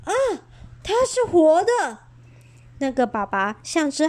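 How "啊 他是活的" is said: high, startled cry of a scared father; scared father squeals in alarm; frightened gasp. Acted. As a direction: blurt it out like a frightened mouse.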